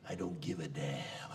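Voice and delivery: lightly whispered Clark Gable line; a hint of a movie-star whisper. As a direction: breathe the famous line, understated. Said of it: "he'd quote, you know, Clark Gable".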